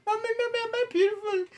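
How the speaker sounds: put-on high childish pleading; mock child voice. A comic asking as the bullied child, high and whiny. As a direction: play the little kid begging mommy.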